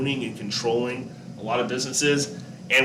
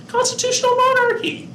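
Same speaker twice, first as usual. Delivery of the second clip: sudden squeaky falsetto, much higher; playful falsetto answer for the class; high-pitched voice; 4 dB louder than usual. Pretend. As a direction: chirp it way up high like an eager kid. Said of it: "say that in a really high-pitched voice".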